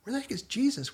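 impatient wondering. Retold or imitated. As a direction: ask it like you're getting restless waiting. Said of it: "I think".

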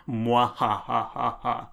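slow mwahaha cackle; evil villain laugh. Retold or imitated. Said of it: "He does a laugh".

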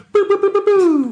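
high, loud mock gunfire; boo-boo like a toy gun; gun sound effect. Mimicking the sword gun shots.

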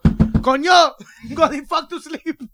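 a hint of a fed-up dad shouting through the wall; mock dad yell. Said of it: "your dad's gonna go".

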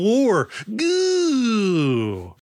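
high comic cry on Goo; mock triumphant squeal at the joke; silly punchline yelp. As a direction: pop the last word up high like a gag.

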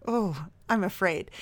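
soft, trembling mock fear; mock fearful. Shown as a demonstration. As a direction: breathe it out fearfully. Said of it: "Dear Carolyn".

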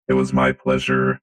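robot voice. Acted. Answers as the robot.

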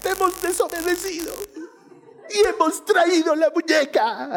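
high, tearful mock-child confession; hammed-up sobbing child voice; crying little girl. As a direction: imitate a small kid bawling out a confession. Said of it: "las tengo a dos enanas llorando".

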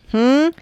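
loud rising questioning hmm; prodding hmm. Retold or imitated. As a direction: prod her with a hmm. Prodding look.

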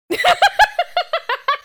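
sudden high-pitched laugh; high burst of laughter. Real, not acted. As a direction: cackle at your own joke. Laughs at own joke.

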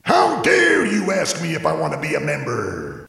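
put-on indignant old-timer shouting; angry shout. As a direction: bellow in outrage. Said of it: "I thought he was gonna say".

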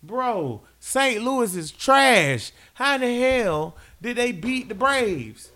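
high, drawn-out disgusted rant; exasperated rant. Real, not acted. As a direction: drag out the disgust at Saint Louis. Ranting about rival team.

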